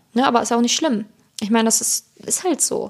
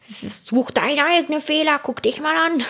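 put-on slow, higher-pitched childish comeback; jokey high-voiced taunt; mock retort; 5 semitones higher, slower than usual. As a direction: play at scolding, drawn out and high. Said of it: "Ich hab jetzt auch nicht gesagt".

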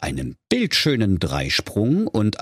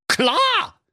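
very high, curt; ninja. Acted. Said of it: "sagte der Ninja".

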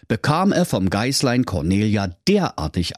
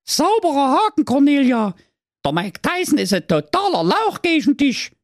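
put-on very high, louder cheering kid voice; praising big sister; 3 dB louder than usual. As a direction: cheer her on. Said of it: "lobte ihr jüngstes Geschwisterlein".

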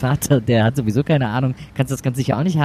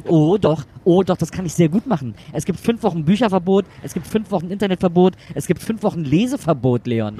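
higher, emphatic punishment list; angry triumph; 6 semitones higher than usual. Acted. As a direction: hammer home each ban. Mother hands out punishment. (wütend und triumphierend)

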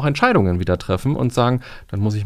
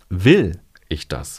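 "Will" punched and drawn out, then falling; slow, hit "Will" first and drop away; stress on WILL; 4 semitones lower, much slower than usual. Shown as a demonstration. Coach showing the third way to ask, stress on "Will". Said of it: "die dritte Betonung ist".

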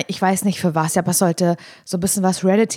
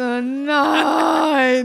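gespielter Aufschrei; 5 semitones higher than usual. Retold or imitated. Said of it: "Ich so".

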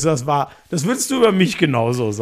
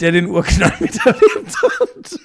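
laughing tease; 6 semitones higher, 6 dB louder, slower than usual. Real, not acted. Teasing age joke, laughing.

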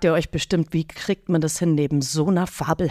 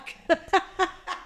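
high laugh; 13 semitones higher, 5 dB quieter than usual. Real, not acted. Tipsy story punchline.

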